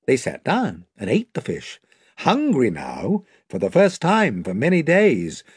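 plain reading voice

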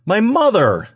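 very high, loud cry; shouting back. A narrator's reading. Woman answering the man about to strike, shouting back. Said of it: "she shouted at him".

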